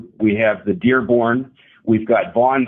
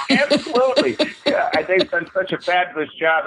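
laughing; 8 semitones higher than usual. Real, not acted. Laughing along with the host.

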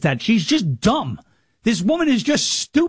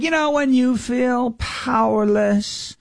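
mock Kamala voice; 3 semitones higher, slower than usual. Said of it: "she was like".

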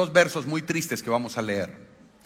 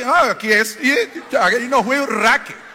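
laughing; 7 semitones higher, 5 dB louder, slower than usual. Real, not acted. Laughing at own blunder.

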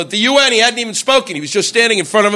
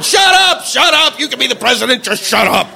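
exasperated yelling; 5 semitones higher than usual. Retold or imitated. Viewer to the president on TV, exasperated yell. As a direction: yell at the TV in mock agony.

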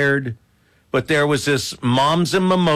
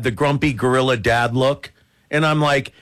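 ordinary talk, nothing marked

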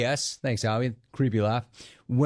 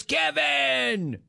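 high called-out name; 12 semitones higher than usual. Acted. Host calls out name.

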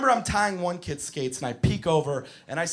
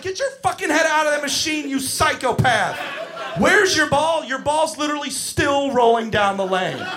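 exasperated rant; 5 semitones higher, 4 dB louder than usual. Retold or imitated. Yelling at kid.

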